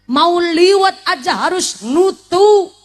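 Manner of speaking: ordinary delivery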